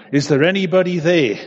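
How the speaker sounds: high, loud desperate cry to heaven; terrified call. Acted. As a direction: call out in terror from the cliff. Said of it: "filled with terror, he called out".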